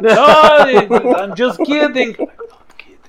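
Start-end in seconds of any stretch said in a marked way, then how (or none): 2.2-3.0 unvoiced whisper, half-joking; whispered aside. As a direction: whisper it as a secret confession. Whispered aside after joke.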